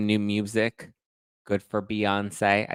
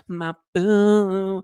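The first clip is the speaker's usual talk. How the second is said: [name] quote; 10 semitones higher, 5 dB louder than usual. Retold or imitated. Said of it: "she was like".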